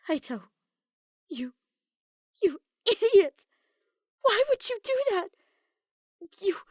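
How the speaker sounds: put-on girl frantic over her poisoned friend; frantic panic. A narrator's reading. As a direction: shout orders through panic. Maki panicking at Kaito.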